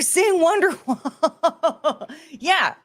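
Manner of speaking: high, slow words breaking into laughter; real laughter. Real, not acted. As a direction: crack up as you say it. Laughs at a chat request.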